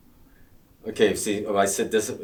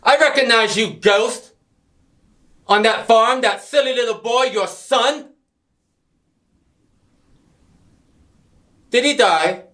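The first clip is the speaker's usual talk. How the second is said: shouting; 11 dB louder than usual. Acted. Villain confronting enemy in scene.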